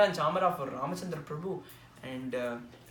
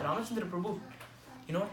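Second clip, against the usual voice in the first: ordinary talk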